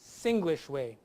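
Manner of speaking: ordinary talk, nothing marked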